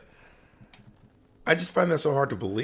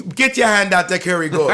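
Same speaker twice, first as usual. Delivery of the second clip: imitating West Indian accent; 5 semitones higher, 6 dB louder than usual. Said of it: "this is me, like, repeating how you said it".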